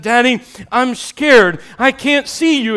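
high, loud frightened cry, slow at first; scared child calling out. Acted. Girl trapped by fire calling down to her dad, frightened.

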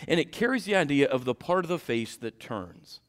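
ordinary talk

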